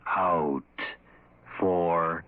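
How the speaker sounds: low, very slow, drawn-out doom; ominous announcer. Acted. Host to listeners, slow ominous low intoning. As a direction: intone it like a curse over the radio.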